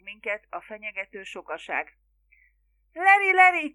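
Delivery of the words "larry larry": high, loud call for help; frantic cry. A narrator's reading. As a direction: cry out to your son. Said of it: "kiáltotta". (magas, kétségbeesett kiáltás)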